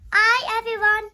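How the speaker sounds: Hi punched loud and high; greeting demo. Shown as a demonstration. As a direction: call out Hi, everyone brightly. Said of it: "Then say".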